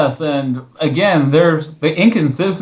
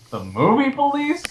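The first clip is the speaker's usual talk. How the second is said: pitched way up in disbelief, rising at the end; incredulous; 13 semitones higher, 5 dB quieter than usual. Real, not acted. Host scoffing at the blogger's movie police line, incredulous. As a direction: scoff at the phrase as if it's absurd.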